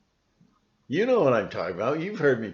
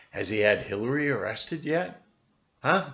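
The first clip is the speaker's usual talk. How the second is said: normal talking voice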